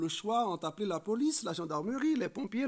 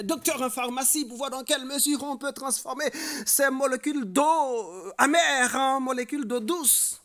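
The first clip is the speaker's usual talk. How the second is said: high, loud, running sermon delivery; full-force preaching; 7 semitones higher, 8 dB louder than usual. Real, not acted. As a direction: build the argument with raised voice.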